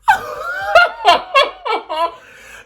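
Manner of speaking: rire très aigu et fort; gros éclat de rire. Real, not acted. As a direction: explose de rire. Fou rire en racontant la chute. (éclat de rire aigu et fort)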